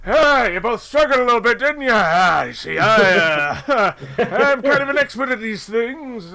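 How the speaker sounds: loud, high, boastful in-character voice; Orvex. Acted. Orvex greets two struggling companions as an expert, loud boastful voice. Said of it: "It's just like".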